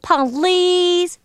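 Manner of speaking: long, high pleading please; begging. Acted. Bird begging the elephant, pleading. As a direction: beg with a drawn-out high note. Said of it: "begged the bird".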